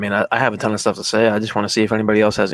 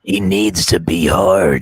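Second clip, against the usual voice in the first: deep announcer voice; 4 semitones lower, 4 dB louder, much slower than usual. Pretend. Deep jokey movie voice.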